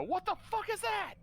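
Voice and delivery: high-pitched shocked outburst; startled yell. Acted. Player's character reacts to sudden danger.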